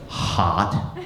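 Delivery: flat, low deadpan answer; deadpan niece. Retold or imitated. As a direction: say it dead serious, low.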